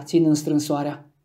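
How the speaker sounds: plain reading voice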